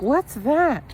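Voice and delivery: high mock falsetto; squeaky prying impression of her; imitating his date. Date asking about the seasoning, in a high mock falsetto. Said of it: "She's like".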